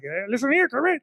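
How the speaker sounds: high silly voice to a toy; playful toy talk. Pretend. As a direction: chat with Kermit like a pal. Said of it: "I gotta be all like".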